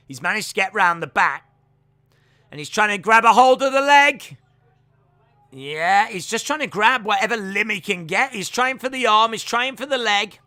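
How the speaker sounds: loud live grappling commentary; play-by-play. Real, not acted. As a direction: call each grab as it happens.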